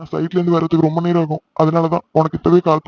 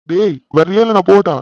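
raised voice, chivvying someone; impatient call; 3 semitones higher, 6 dB louder than usual. Acted. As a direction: call out sharply to hurry him along. Calls to friend to hurry.

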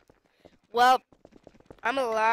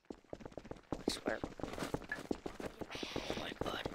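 breathless hushed whisper; 14 dB quieter, much slower than usual. Real, not acted. Gamer whispering to himself after a scare. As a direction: say it under your breath.

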